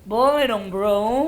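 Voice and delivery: put-on casual cool Jaksel kid talk; Jaksel slang. Shown as a demonstration. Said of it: "peragain bahasa gaul ala anak Jaksel".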